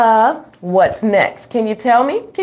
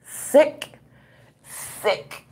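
each sick softer until it's whispered; repeated word trailing off into a whisper; fading to a whisper; 6 semitones higher, 7 dB quieter than usual. Acted. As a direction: let the word die away into a whisper.

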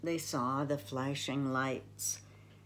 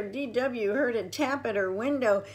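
ordinary delivery

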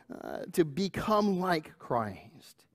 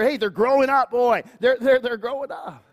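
higher, louder proud exclaim; proud parent; 9 semitones higher, 7 dB louder than usual. Retold or imitated. Parents marveling at kids growing, louder. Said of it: "We, we measure".

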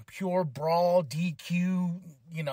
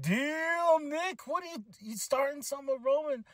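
shocked damn; 8 semitones higher than usual. Retold or imitated. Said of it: "I was like".

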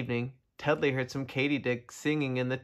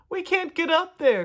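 high, loud cry upward; 5 dB louder than usual. A narrator's reading. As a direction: call up high and loud as the turtles. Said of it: "cried the turtles".

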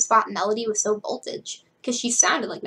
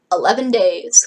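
a louder, doom-laden countdown like the seven days from The Ring; ominous Ring countdown; 8 dB louder than usual. Acted. Claude tells the group about his license, with an ominous horror-movie tone. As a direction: announce it like a horror-movie curse. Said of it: "the tone of, like, the seven days from The Ring".

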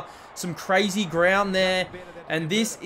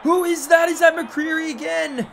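surprised shout; 8 semitones higher, 4 dB louder than usual. Real, not acted. Surprised at goal kicker.

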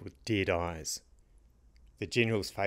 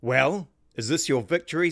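loud, higher savage snarl; 7 semitones higher, 5 dB louder than usual. A narrator's reading. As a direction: snarl it while shaking him. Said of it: "snarled the king".

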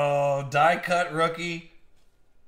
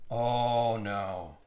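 usual speaking voice

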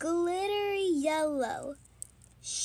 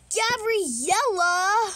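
angry shout; 5 semitones higher, 8 dB louder, slower than usual. Acted.

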